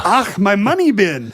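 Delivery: hammed-up Scottish burr opening on "Ach"; Scrooge McDuck. Shown as a demonstration. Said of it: "The secret is to do Scrooge McDuck".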